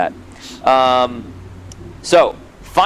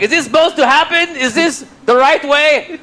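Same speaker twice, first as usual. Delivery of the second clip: anxious Jacques; 8 semitones higher than usual. Retold or imitated. Jacques asking the crew about the descent, higher and worried. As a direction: play the nervous crewman unsure of the route. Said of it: "Jacques' like".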